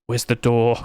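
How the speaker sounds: ordinary talk, nothing marked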